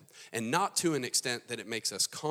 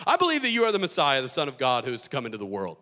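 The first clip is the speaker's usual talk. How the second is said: loud blurted declaration; 3 semitones higher, 10 dB louder, faster than usual. Acted. Martha blurting her belief back at Jesus, loud and hurried. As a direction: blurt it out fast, then settle.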